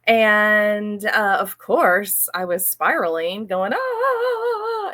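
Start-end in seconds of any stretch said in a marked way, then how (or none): 3.7-4.9 a sudden high strangled "Ah"; inner panic cry. Retold or imitated. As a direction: let out a squeaky panicked gasp.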